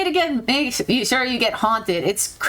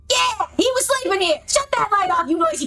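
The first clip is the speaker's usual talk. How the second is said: creature yelling; 8 semitones higher than usual. Acted. Woken creature yells at them.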